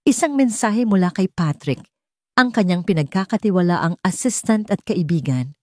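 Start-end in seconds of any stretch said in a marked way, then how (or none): none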